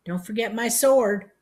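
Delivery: put-on high, loud old-man call; calling after. A narrator's reading. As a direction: call it after someone leaving. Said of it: "Uncle Grady called after me".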